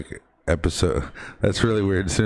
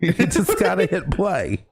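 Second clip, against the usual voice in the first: much higher, exaggerated impression; Christopher Walken; 11 semitones higher than usual. Host echoing co-host's quote, celebrity impression. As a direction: echo the line in a hammed-up voice. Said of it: "So is Christopher Walken getting laid off?".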